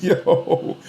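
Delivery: silent wheezing laugh into yo; breathless laugh. Real, not acted. Vlogger cracking up at rival's theory, wheezing laugh. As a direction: laugh without voice, then yo.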